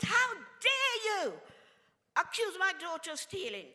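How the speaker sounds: outraged challenge that settles by the end; indignant retort. Retold or imitated. Mother confronting police officers in retold story.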